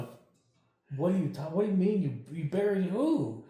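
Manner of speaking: high, loud reenacted disbelief; acted freak-out, voice climbing; incredulous outburst. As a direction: stretch it out in baffled protest. Acted baffled outburst at buddy's revelation.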